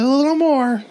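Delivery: high nagging voice; mock picky old lady. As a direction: nag like a fussy customer. Picky customer retold.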